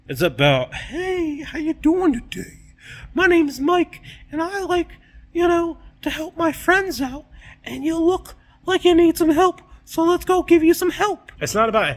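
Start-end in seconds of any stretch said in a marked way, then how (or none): 0.7-11.3 high, louder, overly friendly voice; cheery helpful guy. Retold or imitated. Friendly host welcoming strangers, high cheery voice. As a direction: sell it like an eager nice neighbor.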